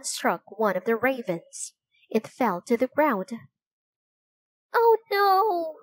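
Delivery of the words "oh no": high and louder gasp of alarm; cry of dismay. A narrator's reading. Annie watching the raven hit by a stone: alarmed cry. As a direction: she sees the bird fall. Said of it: "cried Annie".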